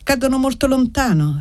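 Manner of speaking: put-on high, small child voice; little girl. A narrator's reading. Child watching fireworks with Annessa, high little voice. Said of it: "riprese la bambina".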